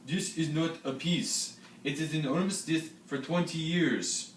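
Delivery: slow, high mock French accent; hammed-up French soldier declaring; a theatrical French accent, drawn out. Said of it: "That was a beautiful accent".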